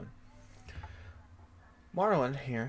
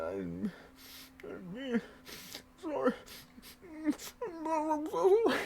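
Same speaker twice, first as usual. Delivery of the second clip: mock tearful apology; 8 semitones higher, much slower than usual. Fake crying apology.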